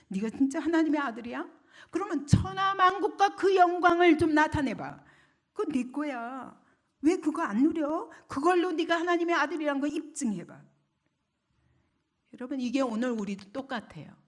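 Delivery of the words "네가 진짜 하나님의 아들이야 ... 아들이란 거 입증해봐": lightly acted goading, pitched up; mocking devil. Devil tempts Jesus with worldly glory, taunting. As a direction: dare him to prove himself. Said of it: "마귀는 계속 조롱합니다".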